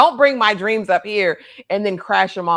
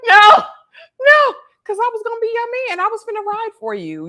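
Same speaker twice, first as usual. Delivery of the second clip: Dunk; 13 semitones higher, 6 dB louder than usual. Retold or imitated. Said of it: "Dunk is like".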